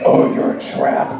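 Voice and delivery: ordinary talk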